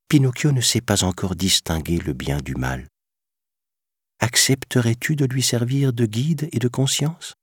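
voix de fée qui monte en demandant; fée bienveillante. A narrator's reading. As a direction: demande gentille d'une fée. (fée aiguë et douce)

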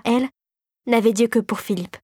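usual speaking voice

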